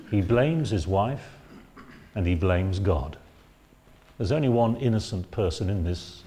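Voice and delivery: ordinary talk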